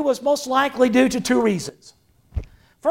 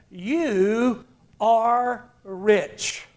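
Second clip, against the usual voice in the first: slow solemn; much slower than usual. Retold or imitated. Jesus quoted solemnly.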